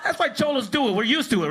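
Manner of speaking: usual speaking voice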